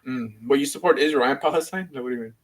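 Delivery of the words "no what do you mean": usual speaking voice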